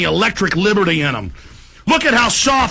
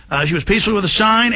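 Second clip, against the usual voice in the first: ordinary delivery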